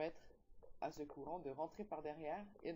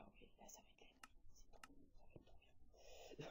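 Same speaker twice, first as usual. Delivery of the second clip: chuchoté; 15 dB quieter than usual. Real, not acted. Chuchote pendant la nuit en jeu.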